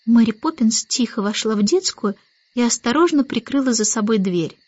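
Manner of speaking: usual speaking voice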